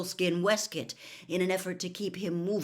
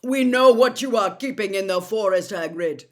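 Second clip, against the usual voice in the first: loud warning call; calling after; 4 semitones higher, 13 dB louder than usual. A narrator's reading. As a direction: call the threat after them. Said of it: "Megorian called after them".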